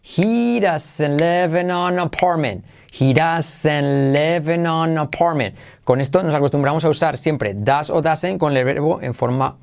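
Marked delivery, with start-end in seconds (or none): 0.0-5.5 slow, stretched, linked syllables for learners; teacher's slow repeat-after-me sentence; slow model phrase. Shown as a demonstration.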